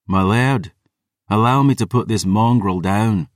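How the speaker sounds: ordinary delivery, nothing marked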